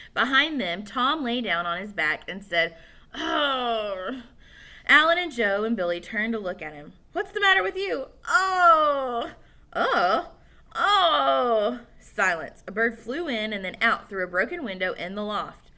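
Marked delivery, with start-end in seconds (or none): 3.1-11.8 high, loud sick moaning; sick groan. Acted. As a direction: moan like you'll throw up.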